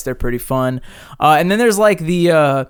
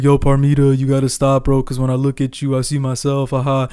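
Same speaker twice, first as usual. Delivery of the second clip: flat, droning bro voice with a lazy laugh; monotone Corbin impression. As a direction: keep it level and dopey, like a chill dude. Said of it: "So Corbin talks like this".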